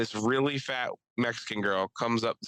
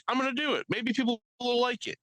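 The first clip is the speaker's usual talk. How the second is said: much higher, naive hopeful voice; mock hopeful self; 12 semitones higher than usual. Mocking himself picking the party shirt, high and hopeful. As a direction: play his past self, pumped up and naive.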